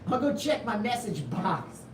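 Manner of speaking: mock old fella checking his mail; lightly voiced oldster; old person voice. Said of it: "I sound like such an old person".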